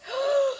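delighted gasp. Real, not acted. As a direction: gasp in wonder. Reacting to quiet dryer.

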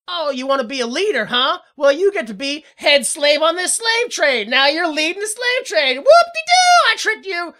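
loud, high gloating taunt; mocking Serling taunt. Serling taunting the wisher with a trick, mocking. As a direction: crow it, peaking in a high whooping yell.

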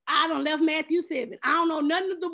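normal talking voice

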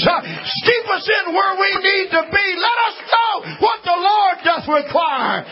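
high, shouted preaching with a cry in the voice; whooping preacher at the peak of the sermon; full-force preaching. Acted.

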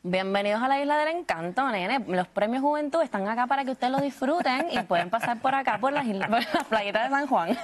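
light Puerto Rican host voice; Puerto Rican accent. Pretend. Guest welcoming viewers to the Premios Juventud, in a light Puerto Rican accent. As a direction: welcome everyone to the island, Puerto Rican style. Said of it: "¿Cómo dirías".

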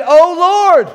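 loud, high cry of "Oh Lord"; "Oh Lord" cried out in desperation; desperate cry. Acted. Desperate prayer acted out.

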